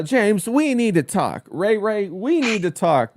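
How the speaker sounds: slow it down and pitch it up, mock-ominous; mimicked woman. Woman summoning her man for a talk, mimicked female summons. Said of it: "this thing that women say".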